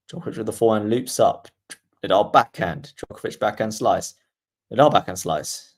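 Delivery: steady commentator call, shot by shot; play-by-play commentary. Acted. Streamer calling the rally for viewers, commentator voice.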